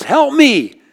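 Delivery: high, loud cry of help me; retold yell for help. Woman caught by the tide yells for help, loud. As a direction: call out for help as the tide drags you. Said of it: "And she yells".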